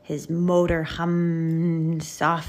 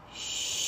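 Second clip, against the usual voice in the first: long, soft hushing; whispered shush; 9 dB quieter than usual. A narrator's reading. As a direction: breathe out a drawn-out hush. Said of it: "he whispered".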